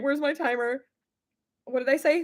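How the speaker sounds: ordinary talk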